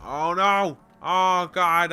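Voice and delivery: sudden loud panicked cry; alarmed shout. Real, not acted. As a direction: yelp out in real alarm. Accidental sprint in game.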